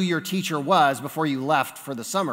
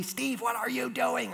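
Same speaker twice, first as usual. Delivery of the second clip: soft, high, urgent stage whisper; hushed, higher voice, trying not to be caught; imitated mouthed words; 7 semitones higher, 9 dB quieter than usual. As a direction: mimic a classmate secretly mouthing across the room. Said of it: "mouth to me".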